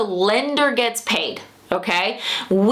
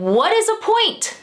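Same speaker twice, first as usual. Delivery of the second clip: confused question; 12 semitones higher than usual. Retold or imitated. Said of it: "they said".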